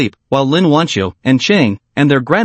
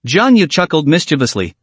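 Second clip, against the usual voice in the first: ordinary delivery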